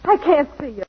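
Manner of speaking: upset pleading. Acted. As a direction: beg him to leave. Pushes Danny away, upset.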